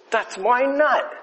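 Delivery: high, slow squirrel voice, pitch well up; a squirrel claiming its nut. Retold or imitated. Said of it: "he goes".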